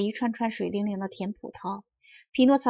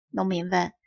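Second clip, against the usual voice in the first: ordinary delivery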